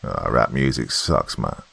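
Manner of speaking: low, lightly strained lifter; grunting between reps. A narrator's reading. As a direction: talk through the effort of lifting. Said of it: "grunting in between reps".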